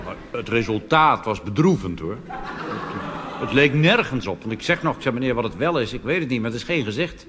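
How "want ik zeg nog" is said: ordinary delivery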